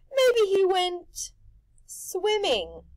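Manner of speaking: slow, high, sly Rictus; hint at something sinister; sly drawl. A narrator's reading. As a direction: Rictus hints slyly.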